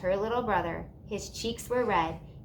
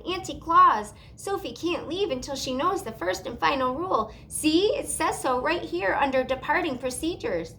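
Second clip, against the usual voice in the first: fussy elf; 7 semitones higher, 4 dB louder than usual. A narrator's reading. Mister Pudding voiced.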